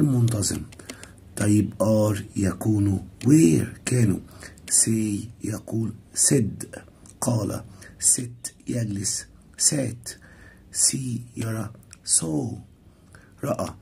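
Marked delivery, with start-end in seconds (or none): 4.7-13.7 soft, slow verb list; slow drill. Shown as a demonstration. Teacher drilling verb forms with students, slow and soft. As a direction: read the forms slowly for learners. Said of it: "verb list read slowly".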